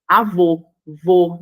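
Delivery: "Avô" with a strong final vô, then "vô" alone; "Avô, vô", stressed syllable isolated; stress on last syllable. Shown as a demonstration. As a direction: punch the vô in "Avô", then repeat it. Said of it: "mais forte".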